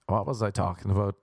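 usual speaking voice

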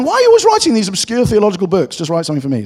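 loud, high-pitched imitation of a grumbling colleague; hammed-up protest at someone's obscure writing; mock exasperated complaint. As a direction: plead loudly, pitched right up. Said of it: "He's been saying".

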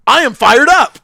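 loud, high hype yell; fired-up shout. Acted.

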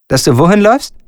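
ordinary talk, nothing marked

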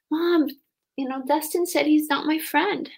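a child telling mom, just lightly played; lightly voiced hurt child.

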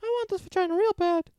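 high-voiced husband arguing with his wife; girly voice. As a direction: play the husband way up high like a girl.

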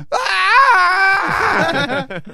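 sudden piercing high scream. Retold or imitated. Screaming into the phone as his buddy answers, sudden piercing high scream. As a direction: blast a scream right into the phone. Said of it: "I scream".

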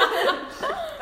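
high-pitched, helpless laugh; real burst of laughter. Real, not acted. Storyteller amused at her own fanfic confession, high laughter. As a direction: crack up laughing. Laughing over having written such fic.

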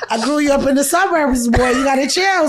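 high, giggling mock scolding; laughing scold. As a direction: tease the son while cracking up. Mother scolding son while laughing.

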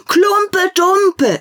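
put-on very high, loud child's cry; children shouting. Other children shouting their choice, very high and loud. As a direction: yell it like a competing kid. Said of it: "schrien andere".

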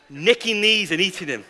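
normal talking voice

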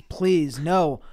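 loud, high pleading; mock begging clerk on his knees. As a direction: beg loudly for the shop, hammed up. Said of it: "He's begging".

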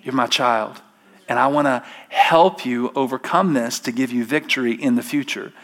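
soft, low and gentle, like a loving father; hushed fatherly comfort; tender voice of God. Retold or imitated. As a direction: reassure them quietly. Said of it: "God comes along and says".

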